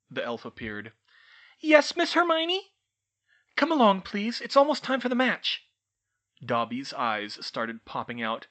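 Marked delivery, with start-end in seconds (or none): 1.6-2.7 high, loud eager elf voice; house-elf answering his summons, high and squeaky. Acted. Dobby answers Hermione. 3.6-5.6 put-on lightly higher girl's voice; a hint of brisk schoolgirl; young witch.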